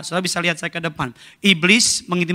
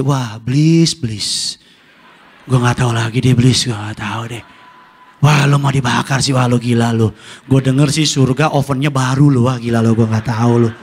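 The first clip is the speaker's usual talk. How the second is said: mock pity; 4 semitones lower, 5 dB louder than usual. Mocking dismissive taunt at devil.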